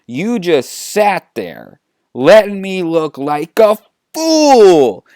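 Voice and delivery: slow build to a high, loud last word; indignant rebuke. Acted. Han feels Chewie made him look foolish, building to outrage. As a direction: stretch out the grievance, then explode on the end.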